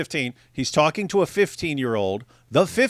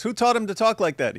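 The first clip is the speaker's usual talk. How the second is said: higher mocking voice; mock quote; 8 semitones higher than usual. Host imitating Sam scolding, higher mock. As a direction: ape the indignant question. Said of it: "he goes".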